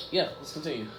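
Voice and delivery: ordinary delivery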